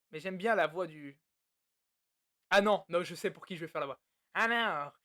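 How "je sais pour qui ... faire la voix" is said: ordinary delivery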